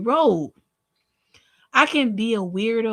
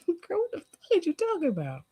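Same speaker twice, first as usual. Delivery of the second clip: incredulous high squeak; 8 semitones higher, 7 dB quieter than usual. Real, not acted. Incredulous reaction to a comment.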